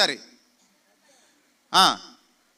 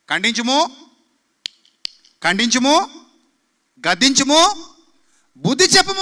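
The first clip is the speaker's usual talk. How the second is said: loud, higher, building scripture cry; fiery pulpit proclamation of scripture; preaching at full force; 5 semitones higher, 9 dB louder, slower than usual. Acted. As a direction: thunder Paul's command at the church.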